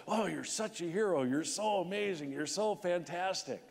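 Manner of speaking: a hint of syrupy interviewer; gushing TV hosts. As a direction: parody fawning morning TV hosts. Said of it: "they're badgering him".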